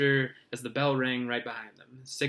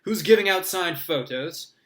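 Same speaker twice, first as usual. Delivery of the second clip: high, loud hearty voice; booming; 5 semitones higher, 7 dB louder than usual. Acted. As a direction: beam at the crowd.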